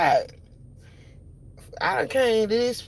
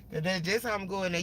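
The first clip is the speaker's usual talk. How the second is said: ordinary talk, nothing marked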